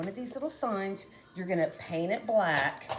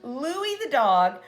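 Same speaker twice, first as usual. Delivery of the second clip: ordinary talk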